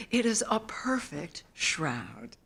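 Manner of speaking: ordinary delivery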